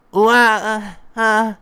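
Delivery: high, loud "wah" wail; loud acted crying in bursts; crying child's wail. A narrator's reading. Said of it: "sounds like a crying child".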